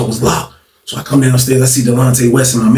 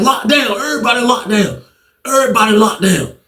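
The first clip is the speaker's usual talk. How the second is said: guard's order; 9 semitones higher than usual. Retold or imitated. Acts out guards ordering lockdown.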